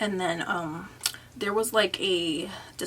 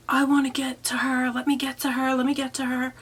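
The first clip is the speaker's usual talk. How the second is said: mild mock yell, higher-pitched; retold scream; 5 semitones higher, 4 dB louder than usual. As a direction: imitate a boyfriend's insistent shouting. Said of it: "her boyfriend screaming".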